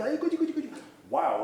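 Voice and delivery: put-on high, cooing mother's baby talk. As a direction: coo at the baby like a doting mum. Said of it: "me dice". (habla aniñada de madre, mucho más aguda)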